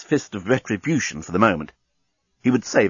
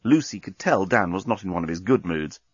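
ordinary delivery

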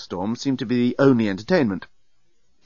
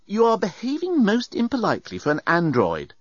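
higher, indignant scolding; stern reprimand; 9 semitones higher than usual. A narrator's reading. Passenger reprimands bellbot, indignant. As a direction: tell the robot off primly.

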